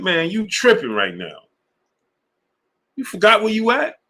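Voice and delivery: imitating friend. Friend on phone, voiced in story.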